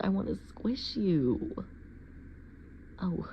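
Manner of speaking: normal talking voice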